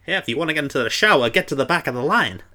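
put-on fast, hammy old-timey voice; hammed-up old movie voice; bad old-timey voice. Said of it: "That's my very bad old-timey voice".

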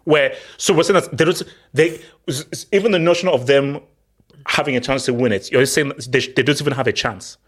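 ordinary delivery

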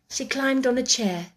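usual speaking voice

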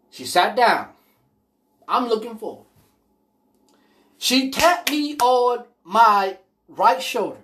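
normal talking voice

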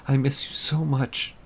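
high, aching plea to a lost love; wife missing her husband, high and longing; tearful longing. Acted. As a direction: cry out for him, voice lifting. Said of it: "she replied".

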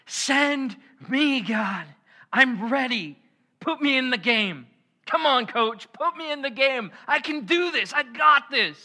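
high, loud pumped-up plea; Isaiah as a player begging the coach; excited. Acted. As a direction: beg the coach to put you in. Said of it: "Isaiah was so excited".

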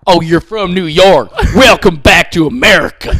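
loud, high mock-announcer bellow; aggressive announcer. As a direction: boom it out like an aggressive heckling announcer. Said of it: "so aggressive".

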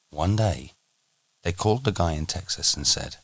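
ordinary talk

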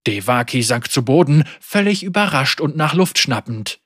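usual speaking voice

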